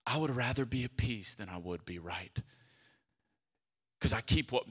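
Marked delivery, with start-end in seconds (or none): 0.1-2.4 low and soft, spoken not sung; quiet restatement. Acted. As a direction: say it softly, almost to yourself. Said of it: "repeated spoken".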